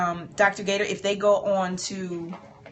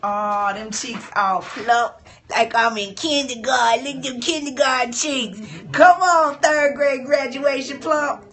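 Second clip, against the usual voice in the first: delighted gushing; 5 semitones higher, 7 dB louder than usual. Real, not acted. Admiring her plump cheeks.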